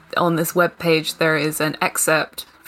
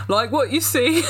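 higher mock British flirt; British accent; 7 semitones higher than usual. Vampire teases the heroine in a mock British accent. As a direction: purr it like a cheesy vampire. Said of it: "I need to do British".

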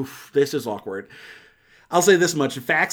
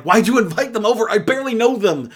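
higher and louder, exasperated; annoyed wife; 8 semitones higher, 6 dB louder than usual. Retold or imitated. Wife confronting her husband about guests, exasperated and loud. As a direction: demand it, fed up and indignant. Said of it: "Julia Louis-Dreyfus is like".

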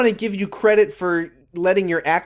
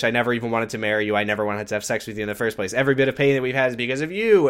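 fast, rattled-off rant; rushed mock quote; 4 semitones lower, much faster than usual. Recapping Ben's cruel speech to Adrian, fast and dismissive. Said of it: "he says".

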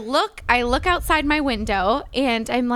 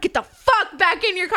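repeated yell; 6 semitones higher than usual. Retold or imitated. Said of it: "I'm like".

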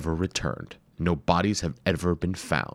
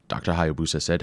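usual speaking voice

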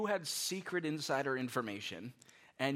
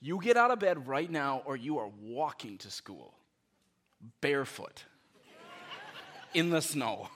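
louder, firm, mock-stern command; stern parent order; 5 dB louder than usual. Parent ordering a still-sleeping kid out of bed, stern and loud. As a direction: play the fed-up parent laying down the law. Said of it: "Now I'm saying".

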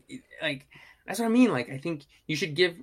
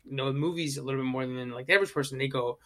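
ordinary talk, nothing marked

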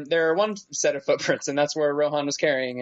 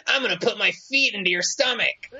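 mock Highway Star voice; 4 semitones higher than usual.

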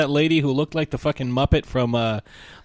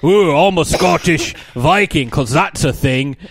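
loud, raised, hammed-up Scottish accent; mock Scottish Viking dad; 3 semitones higher, 5 dB louder than usual. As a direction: roar like a cartoon Scottish Viking. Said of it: "his dad's like".

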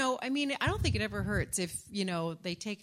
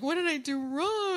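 mock hurt wail; 9 semitones higher, 4 dB louder than usual. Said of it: "of course I took it personally first".

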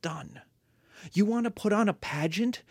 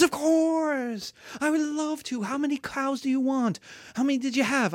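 eager rich patron; 8 semitones higher than usual. Acted. Teacher voicing eager rich guy.

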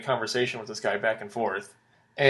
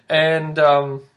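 normal talking voice

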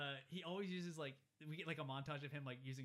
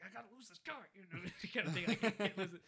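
put-on panicky kid; 6 semitones higher, 6 dB louder than usual. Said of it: "And Hogarth's like".

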